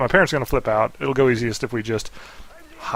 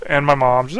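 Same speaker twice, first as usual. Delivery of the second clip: ordinary talk, nothing marked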